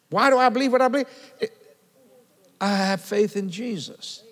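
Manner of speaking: usual speaking voice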